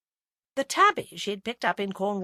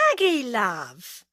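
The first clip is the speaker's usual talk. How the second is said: slow, lilting, louder greeting; 3 semitones higher, 4 dB louder, much slower than usual. A narrator's reading. Roy greeting Agatha on the phone, lilting. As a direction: sing it down the phone line. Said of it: "his voice lilted down the line".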